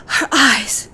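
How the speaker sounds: high, loud startled cry; girl seeing the eyeless woman, shocked; shocked gasp. Acted. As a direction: blurt it out in horror. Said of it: "said Natsu".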